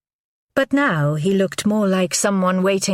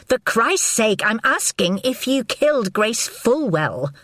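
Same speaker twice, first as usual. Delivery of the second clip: shouting; 6 semitones higher than usual. A narrator's reading. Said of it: "so she shouted".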